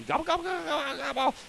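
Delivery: high squeaky gobble-gobble; high turkey imitation.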